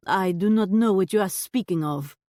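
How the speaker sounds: haughty put-on French accent; scoffing shopkeeper in put-on French; fake French accent. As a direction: brush her off in fake French.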